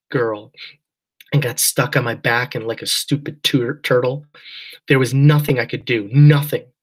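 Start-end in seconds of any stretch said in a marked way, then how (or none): 4.9-6.7 nothing hit louder on the repeat; stressed nothing. A narrator's reading. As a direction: lean hard on nothing. Girl recalls helpless turtle moment.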